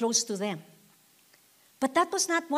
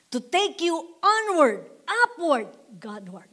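raised emphatic repeat; 5 semitones higher than usual. Shown as a demonstration. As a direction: call out onward with a push. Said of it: "Can I repeat it?".

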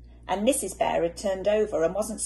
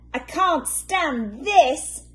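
high, slow, drawn-out moan; slow fed-up moan; weary complaint; 8 semitones higher, 8 dB louder, much slower than usual. Acted. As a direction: drag each word out in despair. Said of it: "said Mister Bear".

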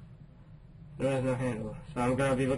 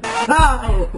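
sudden high yelp of fright; startled scream; 12 semitones higher, 12 dB louder than usual. Real, not acted. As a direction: scream at the jump scare. Jump scare in horror game.

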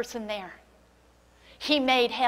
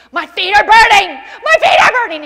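much higher, much louder tormented scream; 9 semitones higher, 17 dB louder than usual. Retold or imitated. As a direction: shriek it as if on fire. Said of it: "she began to scream".

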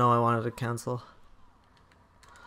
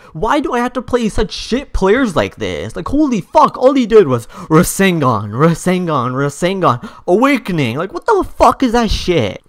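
heated, raised-pitch tirade; indignant rant; 3 semitones higher, 5 dB louder than usual. Real, not acted. Player complaining about shit players' spammed moves, indignant rant. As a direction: rant in outrage about the loss. Rants after losing to spammer.

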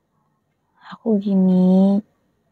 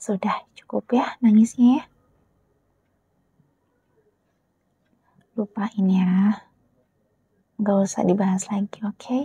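soothing whisper; 3 dB quieter than usual. Acted. Girlfriend telling her crying boyfriend to forget it, whisper. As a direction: gently hush the matter away. Closing the quarrel.

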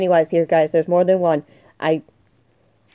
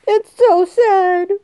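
mock crying; 10 semitones higher, slower than usual. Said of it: "I'm gonna cry".

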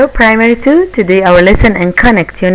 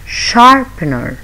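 slow sharpener; 2 semitones higher, slower than usual. Shown as a demonstration. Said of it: "Three syllables".

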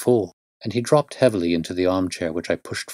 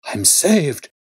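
higher, louder cry of joy; joyful cry; 7 semitones higher, 3 dB louder than usual. A narrator's reading. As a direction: burst out with relief. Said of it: "With a shriek of joy".

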